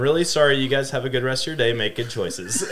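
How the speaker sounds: high, quick, flustered apology; embarrassed mom. Retold or imitated. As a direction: mom backpedaling fast and sweetly. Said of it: "And she's like".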